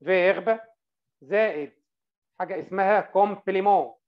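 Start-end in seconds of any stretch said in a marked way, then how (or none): 0.0-0.6 فيرب said louder, slow and clear; stressed term. Shown as a demonstration. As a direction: give the word فيرب a clear teacher's stress. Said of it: "العنصر الأولاني اسمه سوجي زائد". (نطق فيرب بوضوح وتشديد)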